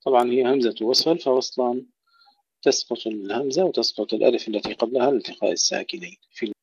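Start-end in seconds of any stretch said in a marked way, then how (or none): none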